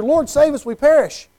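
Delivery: frightened disciples begging; urgent plea in the storm; panicked plea. Acted.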